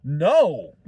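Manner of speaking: ordinary talk, nothing marked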